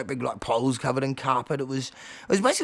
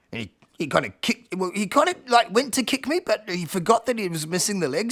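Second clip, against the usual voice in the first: usual speaking voice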